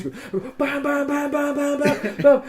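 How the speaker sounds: high clipped bam bam bam like a pistol firing; mock gunshots. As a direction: rattle off the shots with your voice. Imitating gunshots in larp.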